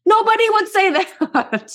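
laughing. Real, not acted. Laughing at absurd idea.

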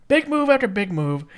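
usual speaking voice

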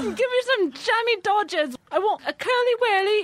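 high, hammed-up fake Cockney; bad British accent. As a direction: overdo a London street voice. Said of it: "bad attempts at British accents".

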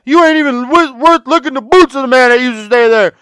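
high, loud imitated tirade; Jane. Jane berating man outside Wild Bill's room, imitated yelling. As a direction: holler the insult with hammed-up scorn. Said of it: "loud-ass mouth yelling and shit".

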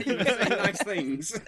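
slow laughing words, pitched high. Real, not acted. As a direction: chuckle through it. Guest laughs after thanks.

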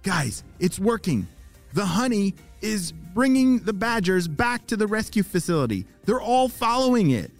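high-pitched, thrilled radio report; excited report. Acted. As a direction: report good news with rising excitement. Jaden reports success by radio.

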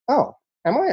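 high, startled little question; mock surprise. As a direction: act caught off guard by praise.

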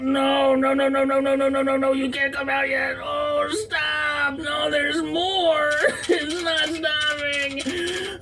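very high, crying, shouting; panicked crying. Acted. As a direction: wail in desperate panic. Crying panic during accident.

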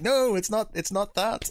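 wife correcting her husband's pronunciation, insistent; mock wife insisting. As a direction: imitate the wife flatly refusing the try. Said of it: "And she's like".

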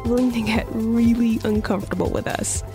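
ordinary talk, nothing marked

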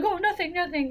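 high-pitched mock innocence; caught-out innocence. Character caught trying on a wig, mock innocent.

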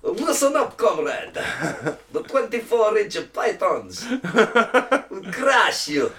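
high, slow, hammed-up wrestler bark; Soviet wrestler promo. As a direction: growl it like a Russian wrestling heel. Soviet Hulk Hogan promo.

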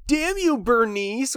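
high, hammed-up curse; mock outrage. Quoting a line from a dodgeball movie, mock outrage.

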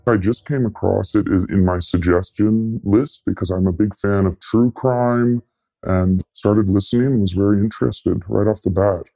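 usual speaking voice

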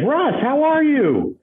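very high, slow gushing greeting; overexcited caller. Retold or imitated. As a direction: stretch it out with joy. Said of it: "He's like".

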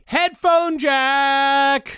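very slow, high reveal in awe; awed. Real, not acted. Dad marvelling at the headphone jack. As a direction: savour each word like a miracle.

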